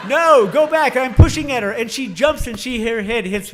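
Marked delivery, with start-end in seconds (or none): 0.1-1.0 frantic shout at the heifer; panicked loud yell; screaming. Retold or imitated. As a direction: yell it, desperate to stop her. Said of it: "I'm screaming".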